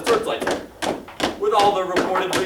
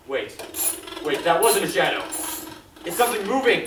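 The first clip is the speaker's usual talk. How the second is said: ordinary talk, nothing marked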